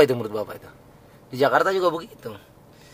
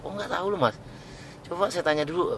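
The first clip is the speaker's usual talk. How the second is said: acted helpful reply; 4 semitones higher, 8 dB quieter than usual. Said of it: "Baru dijawab".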